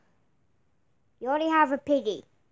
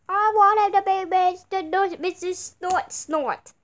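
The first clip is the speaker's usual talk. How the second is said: ordinary talk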